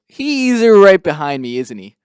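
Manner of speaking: loud, high, drawn-out mock dismay; mock fourth-wall aside. Imitating game heroine caught talking about someone, loud hammed-up aside. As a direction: blurt it slowly to the camera, wildly overacted. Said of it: "My favorite part was Cammy goes".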